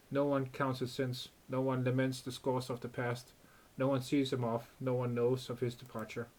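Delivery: even, soft reading of the game's story text; story narration. A narrator's reading. Streamer reading game story to viewers, calm narration. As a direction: read the scene out calmly, a little hushed.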